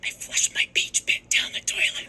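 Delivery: slow unvoiced whispered admission. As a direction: whisper a shameful secret slowly. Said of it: "why do you have to whisper about it".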